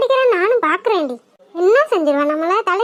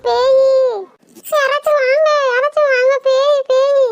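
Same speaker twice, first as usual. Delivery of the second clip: terrified cry for help; 3 semitones higher, slower than usual. Acted. Woman spotting a ghost and screaming for the neighbours, panicked. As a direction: scream for someone to come, scared stiff.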